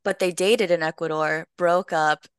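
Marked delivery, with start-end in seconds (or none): none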